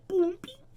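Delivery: whispered playful beep boom; tiny whispered sound effects; whispered beep noises. Pretend. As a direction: breathe the sounds out like a toy. Making hair sound noises.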